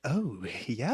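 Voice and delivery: ordinary delivery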